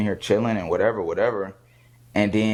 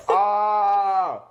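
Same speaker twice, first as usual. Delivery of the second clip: higher, slow shouted panic, voice cracking like a cry; mock panicked yell; 9 semitones higher than usual. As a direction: imitate a frantic man screaming over a car crash. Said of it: "He sounded like [name]".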